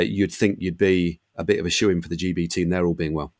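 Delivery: normal talking voice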